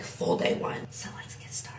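soft whispered start; whispering. Real, not acted. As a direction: breathe the words out. Unvoiced softly.

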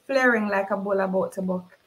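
plain reading voice

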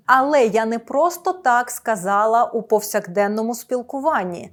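normal talking voice

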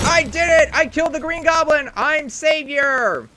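high, loud victory shout; hammed-up hero celebrating the win; triumphant cheer. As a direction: crow over the beaten villain. Triumph after kill.

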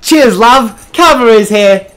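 put-on chirpy British accent, high and loud. Assistant suddenly cheering her boss in a British accent. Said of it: "I don't know why it's gone British".

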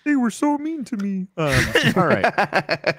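high-pitched mimicry of a friend; mock-plaintive high voice; Lucy. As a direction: echo the friend's complaint in a high put-on voice. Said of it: "that's how she sounds".

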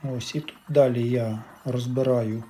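ordinary talk

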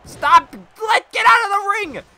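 high, very loud yell; exasperated shout. Real, not acted. Player ordering wrestler out of the ring, exasperated shout. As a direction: yell at them to clear out. Yelling at game characters to stop.

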